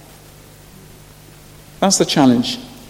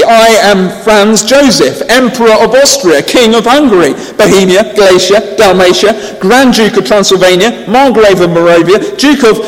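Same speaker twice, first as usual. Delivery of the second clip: more forceful proclamation; 6 semitones higher, 6 dB louder than usual. A narrator's reading. Herald repeating the emperor's titles to the abbot, forcefully. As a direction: insist on every title, louder each time. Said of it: "a bit more forcefully".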